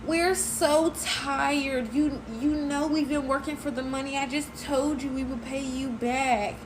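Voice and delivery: high-pitched tearful whine, imitated; mock crying. Storyteller mimicking her tearful friend's excuse, mock crying. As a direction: plead like a whiny friend in tears, drawing out the first words. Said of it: "bursts into tears".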